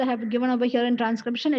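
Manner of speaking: usual speaking voice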